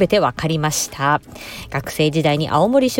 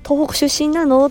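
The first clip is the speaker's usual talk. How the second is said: high, acted happy discovery; light up at finding a fellow local; delighted recognition; 9 semitones higher than usual. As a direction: pretend pleased surprise, pitched high.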